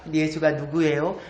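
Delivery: low standard Korean, rising end; standard Korean question. Shown as a demonstration. Speaker translating a grandmother's question, in standard Korean. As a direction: ask it in standard Seoul-style Korean. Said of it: "표준 말로 하면".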